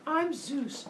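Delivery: high, cheery mock-god greeting; Zeus saying hello. Zeus strolls in to greet the humans, high and breezy. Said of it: "if Zeus were to walk in".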